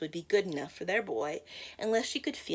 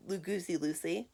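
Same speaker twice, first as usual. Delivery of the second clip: usual speaking voice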